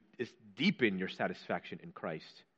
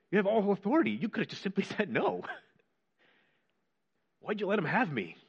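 much higher, half-laughing protest; pleading complaint to Jesus; 7 semitones higher, faster than usual. Retold or imitated. Preacher as Peter protesting to Jesus, high pleading complaint. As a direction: whine it out like a hurt disciple. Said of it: "I would have said".

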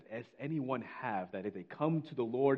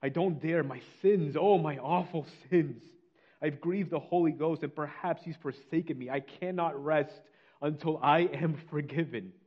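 raised, impassioned confession, dragging on key phrases; crying out; 3 semitones higher, 4 dB louder than usual. Retold or imitated. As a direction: act out a sinner desperate for forgiveness. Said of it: "he begins to cry out".

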